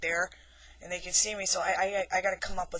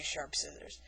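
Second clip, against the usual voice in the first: creepy stock line; 4 semitones lower, 9 dB quieter than usual. Retold or imitated. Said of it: "I'll say".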